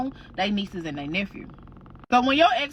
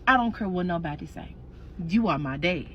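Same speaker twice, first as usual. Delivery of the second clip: put-on lower, earnest child voice; serious son; 7 semitones lower, 3 dB quieter than usual. Son telling his stepfather, lower and firm. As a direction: say it low and firm.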